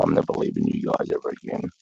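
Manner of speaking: soft, low, unvoiced quote of his vow; half-whispered vow to his family; whispered promise. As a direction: hush it like a private promise.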